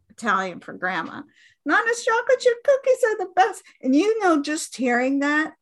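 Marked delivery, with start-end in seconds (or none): 1.7-3.6 put-on high, fast, kid-like voice; high fast kid bragging about nonna's cookies; proud grandkid voice. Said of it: "Like my mom".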